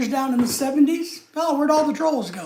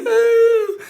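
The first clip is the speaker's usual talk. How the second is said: whiny fake sobbing, much higher and a bit louder; mock crying troll; 6 semitones higher, 3 dB louder than usual. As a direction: ham up a crybaby troll whining to mommy. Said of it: "going back to the basement crying".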